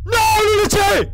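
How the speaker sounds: cri lent, très aigu et fort; cri de désespoir. Real, not acted. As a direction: hurle d'effroi en traînant. Réalise son erreur de tri. (cri de désespoir aigu)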